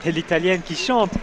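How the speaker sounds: normal talking voice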